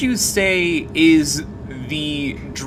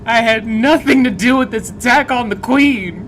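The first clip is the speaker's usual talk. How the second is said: raised, louder pretend protest; silly denial of the attack; mock defensive voice; 7 semitones higher, 5 dB louder than usual. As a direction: insist you're innocent, hammed up.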